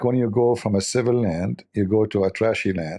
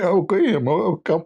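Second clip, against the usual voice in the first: high, strained voice after the chili; changed voice; 9 semitones higher than usual. Retold or imitated. Guest with a burned throat answering the host, high and strained. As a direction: squeak it out, throat burned raw. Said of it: "my voice changed".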